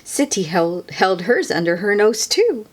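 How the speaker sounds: ordinary delivery, nothing marked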